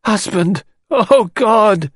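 grieving widow crying out before fainting; anguished wail. A narrator's reading. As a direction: break down into a wail. Widow collapsing over the body.

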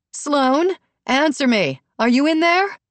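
high loud call through a door. A narrator's reading. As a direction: call to her daughter inside. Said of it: "Brooke banged again on the door".

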